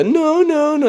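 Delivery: high falsetto coy refusal. Retold or imitated. Mimicking coy women refusing, high falsetto. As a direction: squeal no in a high girly voice. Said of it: "how women are".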